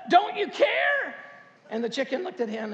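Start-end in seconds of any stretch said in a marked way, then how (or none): 0.1-1.1 high, slow, loud pleading cry; desperate mouse. Retold or imitated. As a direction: plead with the chicken, high and drawn out. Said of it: "And the mouse says".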